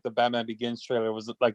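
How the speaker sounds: normal talking voice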